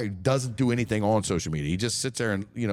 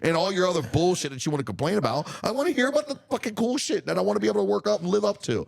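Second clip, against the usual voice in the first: high-pitched heated rant, pushing hard; 8 semitones higher than usual. Real, not acted. Ranting to the podcast co-host about critics, heated and high.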